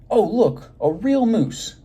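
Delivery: high, loud cry of delight; excited children. Acted. Schoolchildren spot a real moose in class, excited high cry. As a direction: squeal it like kids seeing a moose.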